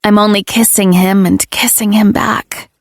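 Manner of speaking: usual speaking voice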